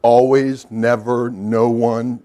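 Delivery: normal talking voice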